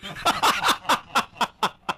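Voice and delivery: a high breathless laugh; breathy laugh. Real, not acted. Teller laughing at his own joke, high and breathless. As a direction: laugh at the punchline you just told.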